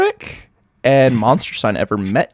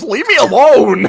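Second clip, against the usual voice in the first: whiny plea; 11 semitones higher, 4 dB louder than usual. Acted. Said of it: "And he goes".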